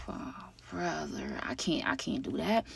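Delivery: slow exasperated whisper; muttered complaint to the camera; whispered oh brother. Real, not acted. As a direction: breathe it out under your breath, slowly. Annoyed aside after girlfriend's demand.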